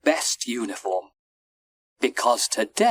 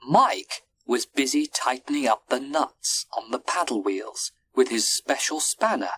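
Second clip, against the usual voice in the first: usual speaking voice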